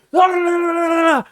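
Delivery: comic high-pitched blather imitating a rant; mock ranting. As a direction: mimic someone's outburst as wordless yammering. Mimics the man's screaming outburst.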